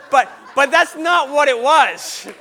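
slow, loud insistence over laughter; emphatic, half-laughing objection; loud protest. Real, not acted. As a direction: shout the correction, drawing it out. Emphatic denial over audience laughter.

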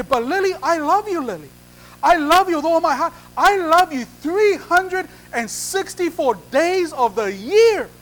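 high, loud protest of love; insistent pleading. Acted. Groom trying to win Lily over, pleading insistently. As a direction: plead emphatically, stretching the big number out.

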